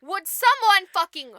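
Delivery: loud, exasperated demand; pissed-off prince. Retold or imitated. Prince returns to the house, pissed off and loud. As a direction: shout it with mock fury. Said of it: "comes back all pissed off".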